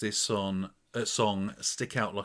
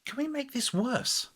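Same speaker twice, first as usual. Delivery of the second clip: high, sneering mock voice; high mocking producer. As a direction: play a smug producer mocking the song. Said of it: "it's like they listened to it and went".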